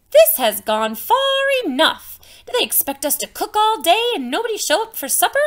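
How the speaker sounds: loud fed-up outburst, then rushed; exasperated mothers. Acted. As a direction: scold like a mother whose supper went cold. Angry mothers in folk tale.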